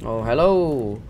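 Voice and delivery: normal talking voice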